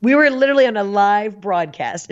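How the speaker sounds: ordinary talk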